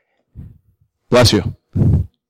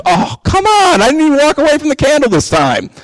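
much higher, fed-up mock complaint; exasperated; 11 semitones higher than usual. Preacher acting out relapse, higher and exasperated. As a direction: throw up your hands, exasperated.

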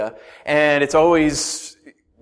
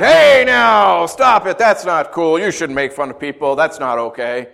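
mock speaking up; 3 semitones higher, 7 dB louder than usual. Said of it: "if you spoke up and said".

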